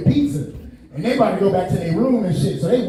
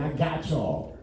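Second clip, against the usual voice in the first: quiet reassurance; 6 semitones lower, 10 dB quieter, slower than usual. Retold or imitated. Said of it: "I was like".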